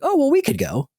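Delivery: парents' change of heart, voiced high and chirpy; mock parents. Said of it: "then they were like".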